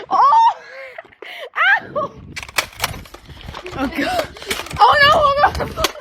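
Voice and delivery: startled cry. Real, not acted. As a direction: jump and yelp "Oh!". Startled during Nerf battle.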